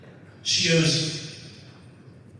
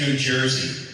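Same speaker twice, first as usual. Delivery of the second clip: normal talking voice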